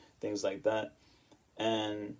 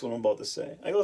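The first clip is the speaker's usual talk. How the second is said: ordinary delivery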